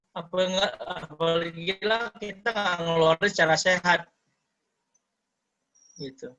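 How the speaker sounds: ordinary talk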